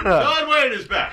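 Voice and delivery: high, slow booming introduction of Don Wayne; hammed-up stage announcer bellow; big announcer call. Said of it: "the Don Wayne returns, ladies and gentlemen".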